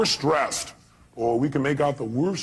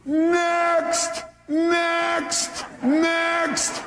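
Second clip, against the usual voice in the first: high, long-held demonstration yell; 13 semitones higher, much slower than usual. Shown as a demonstration. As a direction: holler each word drawn out. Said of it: "you just yell two words".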